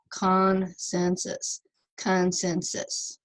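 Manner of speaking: "consensus" slowly, split between the consonants; separated syllables. Shown as a demonstration. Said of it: "separate 'em in between those consonants".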